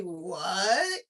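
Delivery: loud, high disbelief; incredulous confrontation. Retold or imitated. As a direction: demand an answer, thoroughly appalled. Confronting the man in her story.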